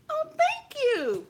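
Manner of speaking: very high, slow saccharine thanks; sugary thanks with menace underneath; hammed-up sweetness; fake sweet. Mock polite thanks before fight.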